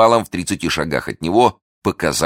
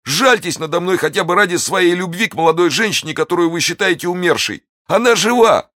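high, desperate pleading; wounded bandit begging the officer; begging for mercy; 13 semitones higher than usual. Acted. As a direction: beg on your knees, voice high. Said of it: "воскликнул разбойник".

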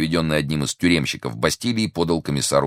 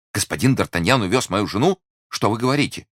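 astonished, higher-pitched questions; startled disbelief; 8 semitones higher, 3 dB louder than usual. Acted. Husband learns from the commissioner about his wife, startled disbelief. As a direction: react with alarmed surprise. Bonacieux shocked by the news.

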